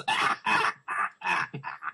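villain's staccato cackle, imitated; Emperor Palpatine. Riffer mimicking the character's laugh, evil cackle. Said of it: "sounds like Emperor Palpatine when he laughs".

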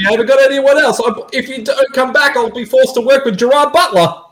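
high, whiny pleading voice. Pretend. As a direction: beg in a squeaky desperate voice. Voicing character pleading.

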